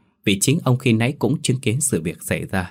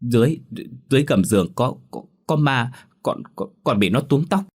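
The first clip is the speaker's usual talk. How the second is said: stammering, higher, scared; shaky frightened voice; 4 semitones higher than usual. A narrator's reading. Daughter tells parents of ghost under bed, frightened stammer. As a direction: stutter it out in fear. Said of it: "vừa nói giọng run run".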